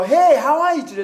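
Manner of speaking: acted upbeat small talk; cheerful greeting. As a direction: greet someone brightly, as if first meeting. Acting out a friendly first greeting.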